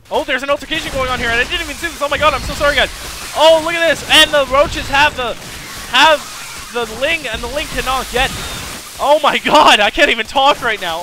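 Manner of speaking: loud, high, fast hype over the fight; excited shouting. Real, not acted. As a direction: yell it like the fight just broke out. Caster hyped by a fight.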